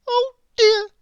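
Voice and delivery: very high crying little bird; tearful bird sigh; small bird near tears, very high; sighing sadly. A narrator's reading. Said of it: "he sighed".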